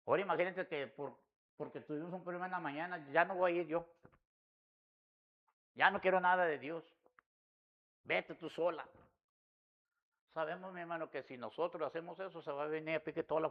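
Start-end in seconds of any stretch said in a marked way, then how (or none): 0.1-8.9 mock sulky refusal. Husband acting out a sulky refusal.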